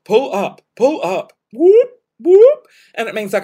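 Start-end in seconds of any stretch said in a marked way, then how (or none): 0.0-1.3 lightly imitated cockpit alarm voice; repeated aircraft alert, mimicked; cockpit warning. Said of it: "like, um, air crash investigation". 1.5-2.7 high, loud imitated siren whoops; rising warning-siren noise by mouth; alarm whoops.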